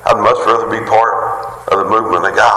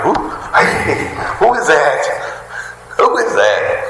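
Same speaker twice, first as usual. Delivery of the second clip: excited response; 6 semitones higher than usual. Retold or imitated. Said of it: "have that same excitement".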